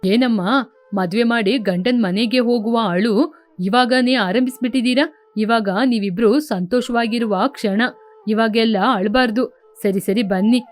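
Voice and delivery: consoling elder. Acted. Elder tells weeping sisters to come and eat, consoling.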